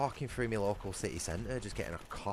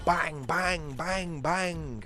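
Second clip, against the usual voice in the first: higher, mock banging noises; imitated stomping; 9 semitones higher, 3 dB louder, much slower than usual. Imitating the stomping neighbour next door, mock banging. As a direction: mimic the neighbour's thumping. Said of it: "What is this stomping".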